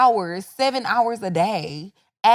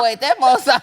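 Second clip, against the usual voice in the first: ordinary talk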